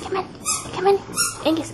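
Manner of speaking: ordinary delivery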